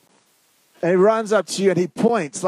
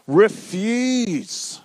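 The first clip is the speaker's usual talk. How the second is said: emphatic, lengthened REFUSE; direct; 6 semitones higher than usual. Shown as a demonstration. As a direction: REFUSE high and drawn out. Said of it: "It's fairly direct".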